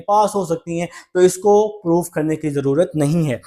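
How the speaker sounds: ordinary delivery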